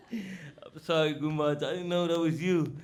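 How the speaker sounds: sorry through leftover laughter; laughing apology. Retold or imitated. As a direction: apologize while still chuckling. Apologizing to guru, still laughing.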